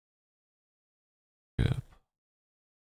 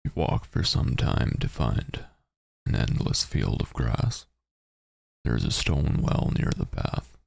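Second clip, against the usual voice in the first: narrating a text adventure; 3 semitones lower than usual. Acted. Reads text adventure aloud.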